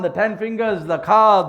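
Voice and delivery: usual speaking voice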